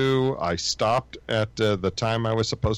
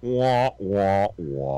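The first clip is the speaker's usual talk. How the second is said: mock trombone wah wah, falling; mock the complaint as muffled wah wah; muffled wah wah talk; 3 semitones lower, much slower than usual. Mocking the other's complaint.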